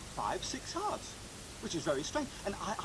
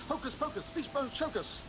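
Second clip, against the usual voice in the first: magic words incantation; 10 semitones higher than usual. Acted. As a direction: cast the spell with a flourish. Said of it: "Say the magic words".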